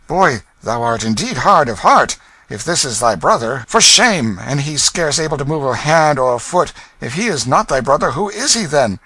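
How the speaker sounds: loud, high reproach; scolding gentleman. Acted. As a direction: shame the heartless boy. Stranger scolds the king.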